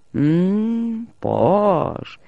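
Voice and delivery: high hum through a mouthful; acted muffled reply while eating; mouth full. A narrator's reading. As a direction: answer with cheeks stuffed. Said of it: "απάντησαν μπουκωμένοι".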